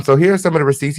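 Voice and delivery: ordinary talk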